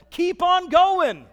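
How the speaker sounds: loud, slow, rising call to keep going; urgent exhortation. Real, not acted. Pastor urging the congregation not to quit, loud and slow. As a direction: drive it home to the room.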